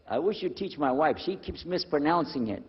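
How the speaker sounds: ordinary talk, nothing marked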